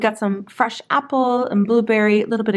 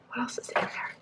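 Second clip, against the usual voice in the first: whispering; 16 dB quieter than usual. Real, not acted. Wondering aloud at the dressing.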